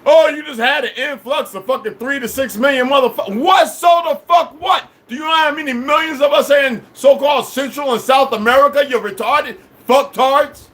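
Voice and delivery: high-pitched, incredulous shouting; real exasperated outburst; angry rant. Real, not acted. Ranting at listeners over population figures.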